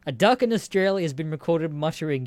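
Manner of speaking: ordinary talk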